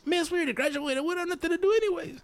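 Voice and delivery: high, mock-whiny retort; imitated protest to a teacher; talking back. Student to mad teacher at practice, talking back.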